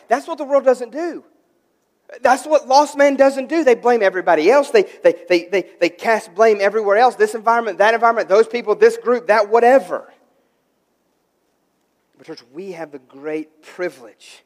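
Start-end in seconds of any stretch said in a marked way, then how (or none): 0.1-10.1 loud, rapid-fire pulpit listing; full-force preaching. Real, not acted. Pastor listing the world's blame-shifting, loud emphatic preaching. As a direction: hammer out each item with rising exasperation.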